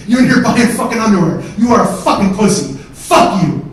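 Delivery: very loud, jeering tirade; scornful shouting. Retold or imitated. Brother mocking him for calling the cops, scornful shouting. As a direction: bellow it with mocking contempt.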